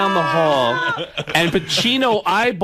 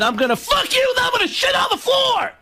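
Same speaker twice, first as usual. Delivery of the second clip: high-pitched, hammed-up yell; mock shouting. Comic retelling his bedroom threat, mock shouting. Acted crude boast in a story.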